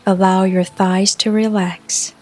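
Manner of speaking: ordinary talk, nothing marked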